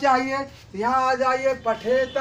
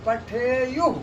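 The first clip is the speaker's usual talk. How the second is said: पठेयुः with extra force on युः; पठेयुः, युः firmly stressed; much slower than usual. Shown as a demonstration. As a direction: lean on the final युः in पठेयुः. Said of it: "युः में थोड़ा बल देना है".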